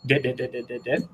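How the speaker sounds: imitated dits. Shown as a demonstration. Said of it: "holding the left".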